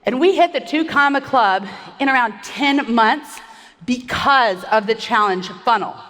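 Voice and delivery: usual speaking voice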